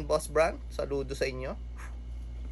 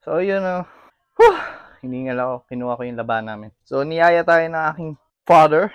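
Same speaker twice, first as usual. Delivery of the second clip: ordinary delivery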